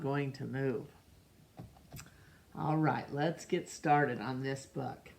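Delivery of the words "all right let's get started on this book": ordinary delivery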